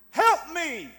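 preacher acting out a desperate cry. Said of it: "it means just cry out".

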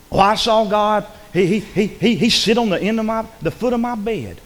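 high, excited mock testimony; mock visionary. Preacher mimics someone claiming to have seen God, high mocking voice. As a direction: play an overeager believer claiming a vision.

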